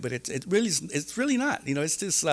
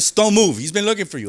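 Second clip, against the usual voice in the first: raised, louder bossy guard voice; mock guard order; 8 semitones higher, 8 dB louder than usual. Retelling the guard's order to him, loud and bossy. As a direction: bark it out like a prison guard. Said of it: "I'm like".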